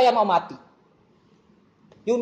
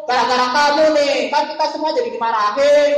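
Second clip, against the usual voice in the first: higher and louder, scolding a classmate; mocking blame; 5 semitones higher, 7 dB louder than usual. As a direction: jeer at the friend who got the class in trouble. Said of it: "jangan malah gini". (mengejek teman dengan suara tinggi dan keras)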